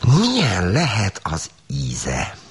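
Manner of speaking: very slow, high, savouring question; curious wondering. Acted. Girl about to taste the chocolate, slowly curious. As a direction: linger on it with greedy curiosity. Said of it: "kíváncsiskodott tovább".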